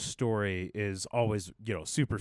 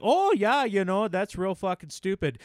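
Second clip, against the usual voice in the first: Boston accent, higher pitched; 9 semitones higher, 3 dB louder than usual. Shown as a demonstration. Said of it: "a lot more pertinent in a Boston accent".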